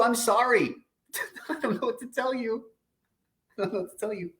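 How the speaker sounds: high, laughing shrug-off; laughing apology. Real, not acted. Laughing off anyone not helped.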